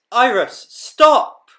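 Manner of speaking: high loud shout at the dog. Acted. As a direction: yell stop at a runaway dog. Bee shouts at naughty dog.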